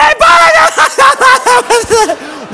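loud, high imitated shriek of laughter; hammed-up helpless cackling; breathless hysterics. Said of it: "she can't breathe".

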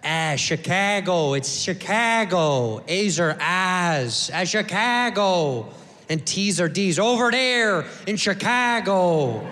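slow, exaggerated Chicago accent; thick Chicago accent. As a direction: put on a drawn-out Chicago twang. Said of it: "with that thick Chicago accent".

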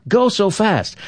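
high-pitched wonder of a joke character; amazed driver. Acted. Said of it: "And he thought".

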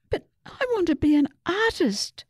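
put-on high, pleading little-girl wail; a storyteller's mild wail, pitched high. A narrator's reading. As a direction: beg your parents to understand, high and strained. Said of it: "Susie wailed".

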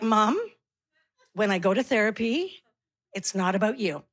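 low, measured, quiet putdown; patient correction. Acted. Grown child to prying mother, patient correction. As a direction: set mom straight, low and deliberate.

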